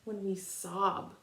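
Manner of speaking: gentle read-aloud picture book voice, long pauses between verses; reading to children. Acted.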